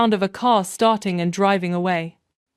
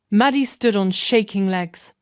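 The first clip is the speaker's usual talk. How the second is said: plain reading voice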